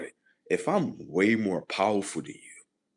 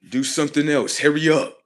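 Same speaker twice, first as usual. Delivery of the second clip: high, very loud shouted commands; acted impatient orders; 9 semitones higher, 12 dB louder than usual. Ordering the next act of disobedience, impatient shouting.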